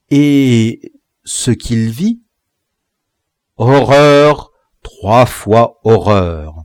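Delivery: slow, drawn-out dramatic narration; storyteller's hushed build to a shock; suspenseful slow reveal. A narrator's reading. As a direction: hold back, then land the horror.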